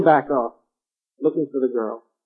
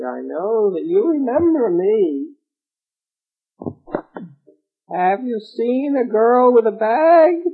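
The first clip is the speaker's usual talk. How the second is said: witch voice; 9 semitones higher, 4 dB louder, slower than usual. A narrator's reading. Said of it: "went up to the tree and said".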